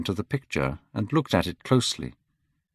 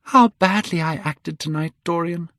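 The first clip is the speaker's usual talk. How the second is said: higher, elated exclamation; joyful cry; 8 semitones higher than usual. A narrator's reading. Sybil greeting Dorian after the play, an elated cry. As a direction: cry it out in happiness. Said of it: "she cried".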